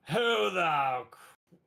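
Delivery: drawn-out loud shout; slow gruff bellow. Acted. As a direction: bellow it out slowly as the captain. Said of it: "I can't say that word so quickly".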